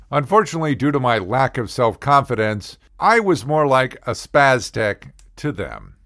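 usual speaking voice

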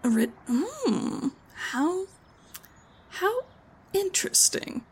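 high, soft, slow intrigued drawl. Acted. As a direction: savour the mystery, rising and hushed. GM voices Karen, intrigued.

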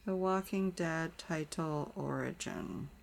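slow, soft search-term murmur; muttering to oneself. Real, not acted. Co-host searching for the title's origin, muttering to oneself. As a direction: say it slowly and softly as you type. Typing a web search aloud.

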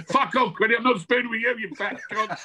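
playful mock anger at a mate; mock outrage. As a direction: bark it back at him in fake outrage. Mock rebuff of spooning joke.

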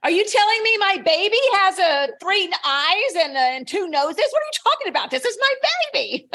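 high mock outrage, laughing at the end; indignant author. Host voicing an author defending her book, high mock outrage. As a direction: play the offended parent of a book. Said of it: "And you're just like".

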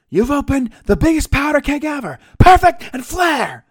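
high, loud wrestling-promo shout; imitating a wrestler's full-volume outburst. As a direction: belt it out as a threat. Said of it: "he's really getting raised his voice".

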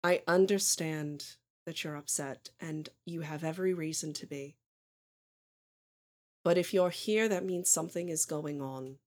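soft, measured mother trying to reach her son; understanding mother. Acted. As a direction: speak quietly and leave long pauses. GM plays the mother.

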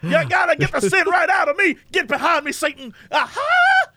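high, hollering revival preacher; fiery preacher. Retold or imitated. Mocking a revival preacher for a friend, high hollering. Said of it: "he's like".

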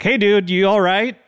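mock check-in on a dazed dude; concerned call-out. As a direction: imitate someone calling over to a passed-out guy. Calling to man passed out on grass.

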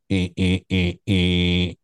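flat, even beeps mimicking a dead phone line; mimicking a landline's disconnect beep; imitated dial tone. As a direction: copy the disconnect tone, eh by eh. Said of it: "and then it would go".

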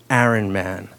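low, grave buddy voice; a hint of a weighty mentor; solemn [name]. [name] consoles, hand on shoulder.

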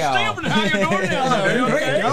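high, loud mock Southern; hammed-up Southern accent breaking into laughter. Shown as a demonstration. Joining in the South impressions, loud mock Southern accent. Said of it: "hear you guys from the South".

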